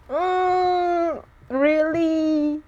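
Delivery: high skeptical "mm, really?"; disbelief. Real, not acted. Skeptical reaction to a comment.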